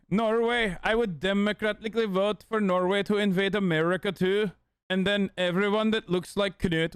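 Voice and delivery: put-on measured, deliberate Norwegian accent. Norwegian invader addressing Americans, careful Norwegian accent. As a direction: play an invading Norwegian laying down rules. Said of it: "that one was the Norwegian one".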